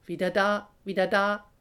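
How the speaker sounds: loud, cackling goose call; chattering geese. A narrator's reading. Returning geese announce themselves, chattering. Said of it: "schnattern die Gänse".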